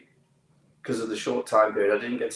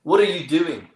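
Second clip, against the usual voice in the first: louder, raised South African challenge; South African accent; 6 semitones higher, 5 dB louder than usual. Retold or imitated. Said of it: "forgive my accent here".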